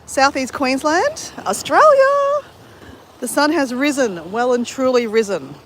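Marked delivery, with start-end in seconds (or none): 0.0-2.5 slow, stretched presenter call with Australia leaping high; drawn-out live-stream intro; grand announcement. Real, not acted. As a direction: announce it like a big reveal. Announcing time and place on stream.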